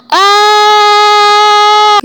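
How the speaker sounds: loud, high held scream; full-volume high shriek; terrified scream. Acted. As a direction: scream long and loud in fright. Screams at returning ghost.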